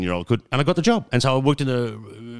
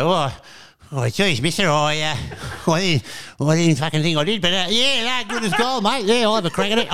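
put-on much higher, giggly, flustered boy; young teen self; 10 semitones higher, 3 dB louder than usual. Imitating his teenage self accepting, high and laughing. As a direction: gush it out half laughing. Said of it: "and I was like".

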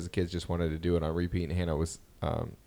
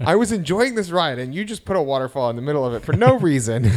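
higher, louder mock complaint to the workers; hammed-up kid grievance; indignant little girl; 5 semitones higher, 5 dB louder than usual. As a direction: play the offended child telling off staff. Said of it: "she was just like".

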